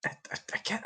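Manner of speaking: ordinary delivery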